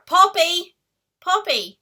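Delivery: sharp loud call to the dog; scolding dog mid call. Real, not acted. Calling the noisy puppy mid-video.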